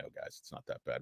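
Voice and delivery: breathy whispered aside. Real, not acted. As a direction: drop to a hush, just air. Unvoiced aside.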